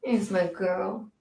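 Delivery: slow, soft pet talk. Real, not acted. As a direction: coo at the dog. Cooing to her dog.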